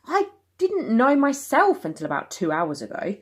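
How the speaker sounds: defensive father. Acted. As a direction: defend yourself, rising to frustration. Parents argue, Dad defends.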